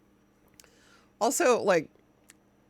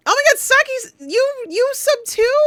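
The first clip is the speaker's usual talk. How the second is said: much higher and louder squeal; excited squeal; 7 dB louder than usual. Real, not acted. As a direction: squeal in disbelief at the viewer. Surprised by a viewer sub.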